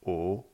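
O rising from mid pitch; "O" lifted like a question; second tone. Shown as a demonstration. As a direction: let "O" climb steadily upward. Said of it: "Avec le son O".

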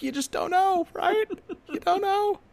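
very high, put-on falsetto tease; squeaky mock voice. Plays teasing game-show choice.

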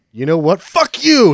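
fuck you flung high and loud; hammed-up retort; mock telling-off. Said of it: "He's like".

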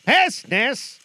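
loud high anguished ghost; ghost crying out. Acted. Marley's ghost cries out to Scrooge. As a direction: cry it out in torment. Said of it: "cried the ghost".

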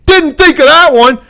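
high, loud mock astonishment; mock amazement. Host mimicking a movie crew's reaction, mock amazed. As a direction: exclaim it as a sudden revelation. Mocking movie character's reply.

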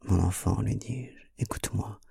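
quiet, intimate appeal; hushed plea. A narrator's reading. Tender, grave plea to his lover.

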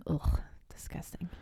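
whispered disgust; breathy, whispered "ugh, disgusting"; annoyed ugh. Real, not acted. Reacting to bourbon chocolate pie.

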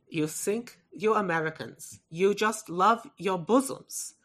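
put-on European accent, deliberately paced; mock European accent, measured and teasing; Christoph Waltz. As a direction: imitate a European actor's accent, drawing out the end. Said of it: "delivered by Christoph Waltz would be brilliant".

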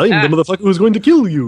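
action hero line. Pretend. Friend hams up action hero line.

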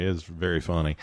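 reminiscing film star, lightly imitated; Paul Newman. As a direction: ease into a mild impression of a storytelling actor. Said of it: "Newman is like".

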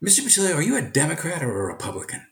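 student asks his teacher a political question, lightly voiced; curious student. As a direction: lightly voice a bright ninth grader asking. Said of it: "And he said".

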